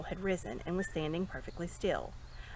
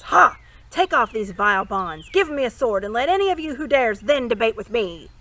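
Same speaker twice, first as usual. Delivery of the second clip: loud, scornful ranting with barking laughs; furious defiance; 9 dB louder than usual. A narrator's reading. Captive prince rages at the lords in fury. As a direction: shout him down with contempt.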